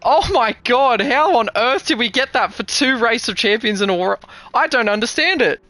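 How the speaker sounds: high, loud, amazed; breathless excitement. Real, not acted. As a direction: still buzzing after the win. After the finish, still buzzing.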